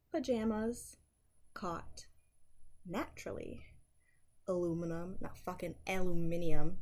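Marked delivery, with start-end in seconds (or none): 5.2-6.8 louder, indignant jab at the British spelling; mock outrage, punch it out; loud mock protest. Refusing the British word in a loud mock protest.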